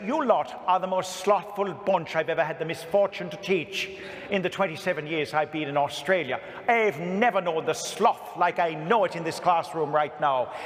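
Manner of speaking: put-on Irish accent, rising to a shout; harsh Irish schoolteacher tirade; Irish nun accent. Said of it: "sorry for the Irish here, 'cause I always do the accent".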